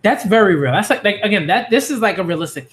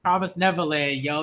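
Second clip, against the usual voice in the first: usual speaking voice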